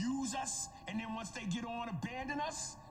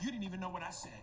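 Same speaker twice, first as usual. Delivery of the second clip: normal talking voice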